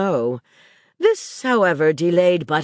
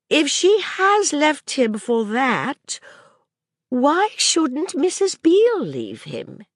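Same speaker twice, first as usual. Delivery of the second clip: put-on higher, earnest little girl's voice; a young girl working things out aloud; child Maisie; 10 semitones higher, slower than usual. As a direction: speak as the curious child arguing.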